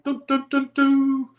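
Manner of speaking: high-pitched beeping dial tone, sung on one note; high mock dial tone beeps; dial tone imitation. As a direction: imitate the dead line after a hang-up. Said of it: "you're gonna hear a dial tone".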